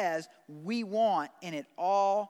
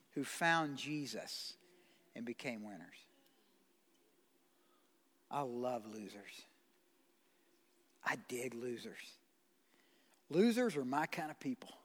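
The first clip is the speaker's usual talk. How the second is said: softer, lower confiding preaching; 8 semitones lower, 7 dB quieter than usual. Acted. Preacher confiding his love of losers, much lower and softer. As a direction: drop low and soft, as a confession. Intimate turn in sermon.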